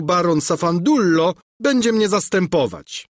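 high, loud fussy welcome; overly gracious prince; pompous prince. A narrator's reading. Pompous prince greets captain. (napuszony książę wita kapitana)

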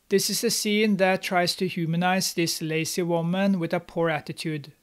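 normal talking voice

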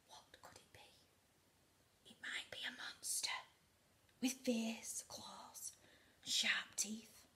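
spooky storytelling whisper; suspense whisper. A narrator's reading. Suspense narration.

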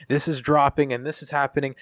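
mock hype announcement. Mocking his own hollow announcements, hyped promo voice.

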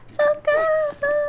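high, drawn-out hammed-up "help!"; mock cry for help. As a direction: wail it slowly like a damsel in distress. Stuck on stairs, calling for help.

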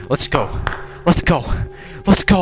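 starts firm, ends shouting; building hype shout. Real, not acted. Psyching self up, building.

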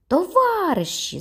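very high, slow call to the crowd. A narrator's reading. Judge calls out to the whole crowd. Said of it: "закричав суддя в білому костюмі".

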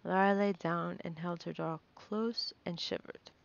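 plain reading voice

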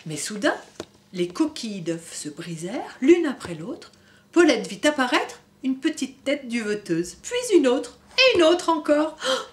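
put-on gentle storybook reading for kids; story narration. A narrator's reading. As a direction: read it like a bedtime book. Reading picture book to children. (lecture d'histoire pour enfants)

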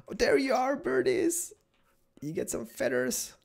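very high, sing-out sweet voice; squeaky-high delighted baby talk; cooing at birdies. Pretend. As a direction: coo to tiny creatures. Cooing at birds just found.

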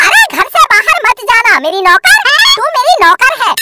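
ordinary talk, nothing marked

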